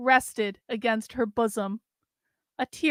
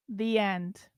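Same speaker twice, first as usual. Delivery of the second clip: plain reading voice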